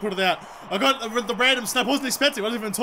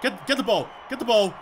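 ordinary delivery

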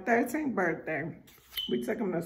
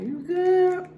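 much higher, louder, upbeat check-in; instructor checking in; 11 semitones higher, 7 dB louder than usual. Retold or imitated. Skydive instructor calling to her mid-fall, upbeat and loud. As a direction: call it out cheerfully to a jumper. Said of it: "The guy behind me".

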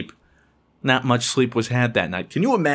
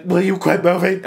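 annoyed brother; 6 semitones higher, 4 dB louder than usual. Retold or imitated. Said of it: "Brother's like".

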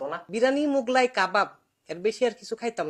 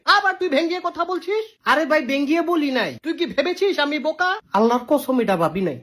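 normal talking voice

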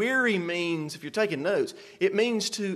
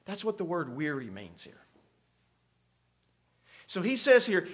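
usual speaking voice

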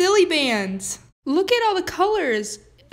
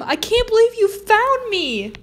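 relieved excitement; 7 semitones higher, 4 dB louder than usual. Acted. Kid reunited with mom.

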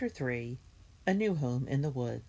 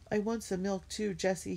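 begging; 7 semitones higher than usual. A narrator's reading. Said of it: "he begged".

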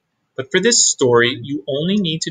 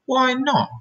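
innocent question; 12 semitones higher than usual. Acted. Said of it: "asked Wilbur".

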